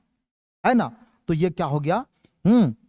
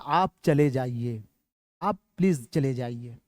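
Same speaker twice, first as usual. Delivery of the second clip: much softer, lower pleading request; polite request; 8 semitones lower, 7 dB quieter than usual. Shown as a demonstration. Teacher contrasting a request with the command, soft and polite. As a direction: ask them kindly to please leave. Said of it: "یہ گزارش ہو گئی".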